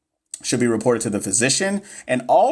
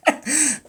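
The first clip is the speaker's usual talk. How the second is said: a short burst of laughter, pitched up; amused laugh pitched up; high laugh; 13 semitones higher than usual. Real, not acted. Laughs at own classmate story.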